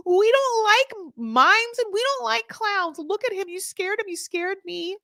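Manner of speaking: high, drawn-out mock indignation; mock outraged wife. Wife scolding over mimes and clowns, mock outrage. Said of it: "she got to be like".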